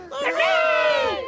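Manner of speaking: high, loud squeaky hooray; shouted cheer. A narrator's reading. Sea creatures celebrate freed fin, high shout. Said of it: "shouted the sea creatures".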